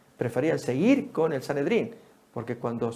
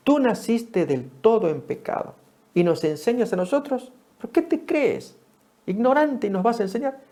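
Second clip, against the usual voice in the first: mocking, higher, slowing on te crees; scornful rebuke; 8 semitones higher than usual. Pharisees scorning the healed blind man, high and mocking. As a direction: scold him like a contemptuous Pharisee.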